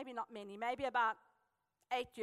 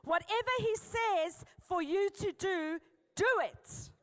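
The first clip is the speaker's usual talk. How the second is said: louder, slower, firmly declared; with more conviction; 6 semitones higher, 4 dB louder, slower than usual. Shown as a demonstration. Preacher leading the congregation to declare it with conviction. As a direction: say it like you truly mean it. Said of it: "say it with more conviction".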